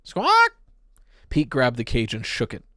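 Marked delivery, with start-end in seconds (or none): 0.0-0.5 very high, loud parrot squawks. A narrator's reading. Parrot squawking back at Pete, loud and very high. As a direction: screech like a wild parrot. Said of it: "It flapped its wings at Pete".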